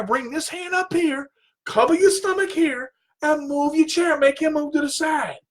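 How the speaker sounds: high-pitched, insistent correction; emphatic coaching. Retold or imitated. Coach telling student to just move the chair, insistent. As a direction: drum the lesson into the student.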